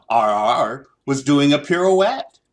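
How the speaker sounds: ordinary delivery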